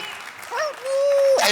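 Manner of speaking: loud, very high strangled squeal; choking voice. Acted. Schoolboy standing before the whole class, loud choking squeak. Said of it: "I stood up and went".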